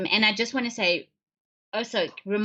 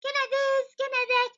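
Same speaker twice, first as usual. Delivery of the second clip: put-on much higher, sugary child voice; sweet little child. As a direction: wheedle mommy like a cuddly little kid. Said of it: "And then it's like".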